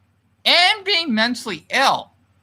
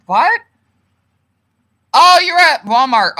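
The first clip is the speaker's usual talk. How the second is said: surprised; 7 semitones higher, 8 dB louder than usual. Real, not acted. Reacting to a surprising comment.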